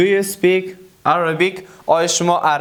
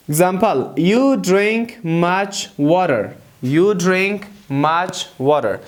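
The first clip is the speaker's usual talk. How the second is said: very slow, word by word; slow dictation; much slower than usual. Shown as a demonstration. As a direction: dictate the example to learners. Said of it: "teacher models slow English".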